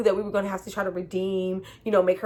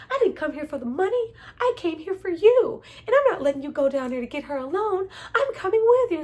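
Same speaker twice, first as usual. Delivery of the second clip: mock love speech; 9 semitones higher than usual. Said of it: "She's like".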